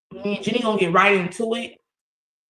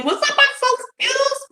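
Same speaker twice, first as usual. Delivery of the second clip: much higher, mock-confused squeak; squeaky high voice. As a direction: squeak it out playfully.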